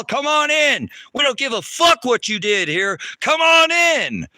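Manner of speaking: loud, high mock invitation into hell; mocking devil welcome. Streamer mocking the devil's welcome to hell, loud high sneer. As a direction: sing it out like a mocking devil at the gate.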